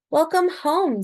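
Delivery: hint of a mother's cheerful welcome. A narrator's reading. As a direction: greet her child home as a gentle mum. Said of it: "said her mother".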